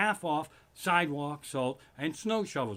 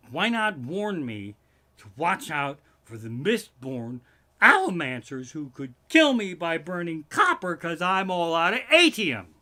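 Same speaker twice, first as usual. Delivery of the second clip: builds to much louder punchline; building shout; 7 dB louder than usual. Real, not acted. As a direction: ramp it up to the punchline. Punchline about Mistborn allomancers.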